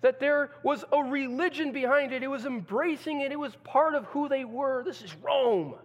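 loud, high preaching at full force; preacher thundering about Rome; fiery preaching. Acted. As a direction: drive it home from the pulpit.

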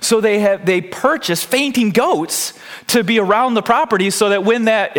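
usual speaking voice

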